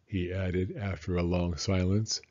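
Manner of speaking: ordinary delivery, nothing marked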